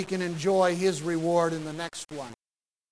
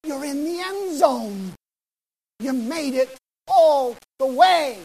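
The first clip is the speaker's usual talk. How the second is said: slow, louder, higher proclamation; the Lord greeting the faithful at the finish; the Lord's voice; 7 semitones higher, 6 dB louder than usual. Retold or imitated. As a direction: announce it like a heavenly welcome. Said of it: "the Lord's gonna look at us and he's gonna say".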